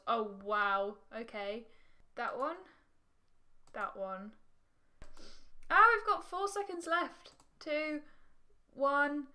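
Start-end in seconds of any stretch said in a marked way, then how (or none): 5.7-9.3 high, loud countdown alarm; panicked. Real, not acted. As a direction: cry out as time runs out. Panics as game timer runs out.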